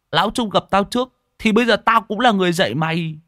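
high, indignant comeback rising in pitch; pouty retort. Acted. Tý answers Đen's scolding, pouty high retort.

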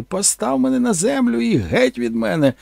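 high screaming demand; mock screaming actress. Actress punching the creature and screaming, high mock scream. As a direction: shriek to be let go. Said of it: "почала бити мене кулаками і кричати".

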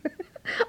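mother laughing; amused laugh. Acted. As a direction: laugh at the badkuip film.

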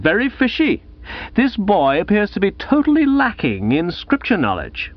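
high-pitched pompous suspicion; Gussie, accusing prize-giver. Acted. Gussie turns to the bearded bloke, accusing and pompous.

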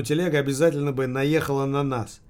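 ordinary delivery

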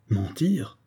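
an officer stung at being called a liar; stunned echo. A narrator's reading. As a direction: echo the word in shocked disbelief. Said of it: "répéta Eric en rougissant".

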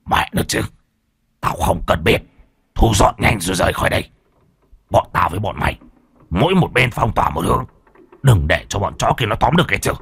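hissed, urgent orders. Acted. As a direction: hiss it out, fast and tense. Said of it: "Lão rít lên".

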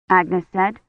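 ordinary delivery

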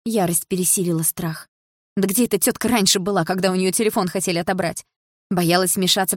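usual speaking voice

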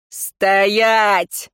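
drawn-out bellowed order; barked command. A narrator's reading. Nobleman stops the archer, barked command. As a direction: bellow it to halt them. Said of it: "гаркнул Риор".

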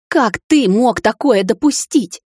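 slow, loud reproach; angry reproach. A narrator's reading. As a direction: scold him, slow and loud. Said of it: "зашипела она". (медленный громкий упрёк)

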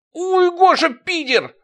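high pained yelp; pained yell. Acted. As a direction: cry out from a broken tooth. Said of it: "закричал он".

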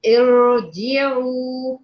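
ارجعوا drawn out very slowly, the opening hamza voiced clearly; pronunciation demo. Shown as a demonstration. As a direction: stretch out ارجعوا slowly, sounding the hamza first. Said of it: "لما جيت أنطق قلت إيه؟".

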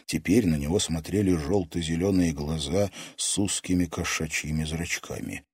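plain reading voice

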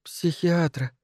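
soft voice of stunned disbelief; shocked echo. A narrator's reading. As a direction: echo it faintly, taken aback. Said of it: "Этель потрясённо поднесла ладони".

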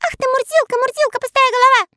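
high squeaky teasing voice; mocking elf voice. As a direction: tease him in a tiny high voice. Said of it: "Насмехались над ним братья". (насмешливый тонкий голосок)

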